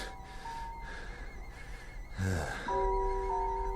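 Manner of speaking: long, soft weary sigh. Acted. Stranded captain alone with his doubts, weary sigh. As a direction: let out a long tired breath.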